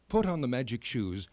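plain reading voice

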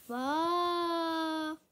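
slow, stretched-out reading; drawn-out reading. Shown as a demonstration. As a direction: stretch the words out slowly. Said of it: "I did that because it says near and far".

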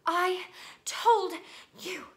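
high, loud gasped reproach; gasping. A narrator's reading. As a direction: gasp it out past a stitch in the chest. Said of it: "Hermione gasped, clutching at the stitch".

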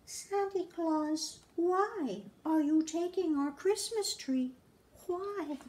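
put-on wide-eyed tiny girl asking; small child voice. A narrator's reading. Little Cindy Lou asks Santa, in a high small voice. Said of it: "She stared at the Grinch and said".

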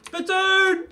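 loud, high exclamation; excited shout. Real, not acted. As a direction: shout it out with delight. Excited shout at game item.

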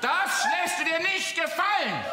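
now yell it out in fury; shouting. Acted. A man talking to himself, now shouting angrily. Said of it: "Warum schreist du denn dann so rum".

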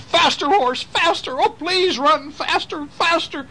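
high, panicked shriek. Acted. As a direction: beg the horse in terror. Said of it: "Ichabod shrieked".

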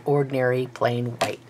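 usual speaking voice

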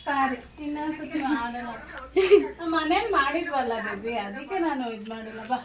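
soft coaxing apology. Real, not acted. Parent says sorry to crying birthday child, soft coaxing.

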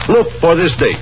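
ordinary talk, nothing marked

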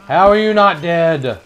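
high loud disbelief; frustrated shout. Real, not acted. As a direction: yell it in disbelief. Frustrated during fight.

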